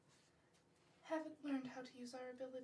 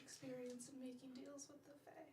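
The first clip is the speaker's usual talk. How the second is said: whispered worry; hushed and unsure; 6 dB quieter than usual. Real, not acted. Bard whispering doubts to the party about fae deals.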